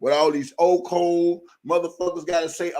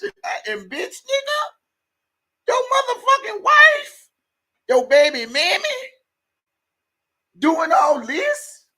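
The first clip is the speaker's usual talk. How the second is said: furious rant; 5 semitones higher than usual. Real, not acted. Ranting at Angelo over his parenting, furious high yell. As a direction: voice climbs much higher into an outraged yell.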